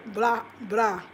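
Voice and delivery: usual speaking voice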